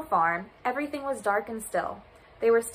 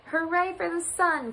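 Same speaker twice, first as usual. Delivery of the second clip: high, slow cheer; joyful cheer; 7 semitones higher than usual. A narrator's reading. Animals celebrating the sun's return, cheering high. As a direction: cheer it out, drawing out the words. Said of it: "cried Goat and Pig and Duck".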